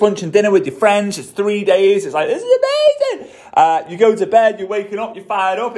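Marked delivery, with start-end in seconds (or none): 2.3-3.4 very high, laughing delight; thrilled squeal. Retold or imitated. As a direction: squeal it out, over the moon. Quoting hyped event-goer.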